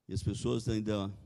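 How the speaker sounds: ordinary talk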